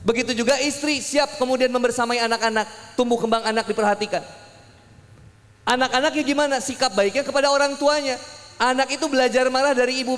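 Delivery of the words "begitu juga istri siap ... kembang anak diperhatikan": normal talking voice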